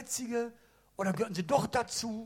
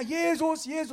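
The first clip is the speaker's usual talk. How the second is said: calling out; 8 semitones higher than usual. Acted. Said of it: "Und er schreit noch mehr".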